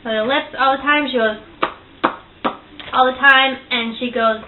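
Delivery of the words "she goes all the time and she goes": usual speaking voice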